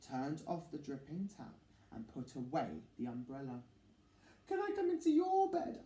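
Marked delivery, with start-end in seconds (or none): 4.5-5.8 high small begging voice; pleading little mouse. Acted. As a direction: ask sweetly to be let in.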